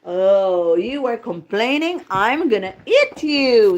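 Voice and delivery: loud storybook wolf threat; threatening wolf. Acted. Wolf tells complaining grandma his plan, loud and menacing. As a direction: act a big bad wolf, hitting the threat hard. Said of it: "said the wolf".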